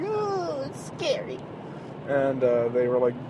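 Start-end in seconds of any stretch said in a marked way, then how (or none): 0.0-1.4 high, soft, wimpy mock fear; mock scared. Host mocking overly sensitive viewers of an ad, mock scared. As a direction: imitate an oversensitive scaredy-cat, high and small. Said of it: "overly sensitive pussies out there".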